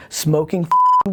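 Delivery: usual speaking voice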